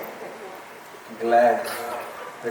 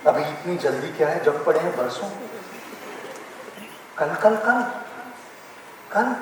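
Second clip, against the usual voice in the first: mocking procrastinators; 7 semitones lower, 7 dB quieter, much faster than usual. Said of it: "लोग कहते हैं कि".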